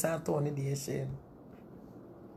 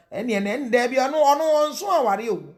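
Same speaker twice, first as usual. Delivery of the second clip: high, loud, slow mock exclamation; mocking punchline; 8 semitones higher, 8 dB louder than usual. Real, not acted. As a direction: stretch it out, scoffing.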